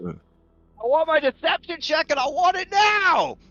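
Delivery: high, loud mock-angry demand; hammed-up, high-pitched yelling. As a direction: holler for it like an impatient tyrant. Game master mock-demands the roll.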